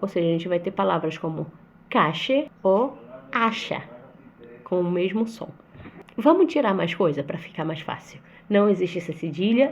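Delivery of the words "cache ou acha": slow "cache ou acha" with a sh sound for ll and y; rioplatense sh sound. Shown as a demonstration. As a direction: Buenos Aires "sh" in "cache" and "acha". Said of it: "som de xaxe".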